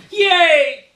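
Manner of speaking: put-on very high, loud kid's cheer; joyful yell. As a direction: shriek it with delight. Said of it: "he yells".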